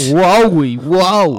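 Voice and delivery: big surprised whoa; loud whoa. Acted. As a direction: shout it out in surprise. Cohost reacts loudly.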